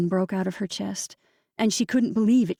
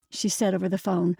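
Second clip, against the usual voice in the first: ordinary delivery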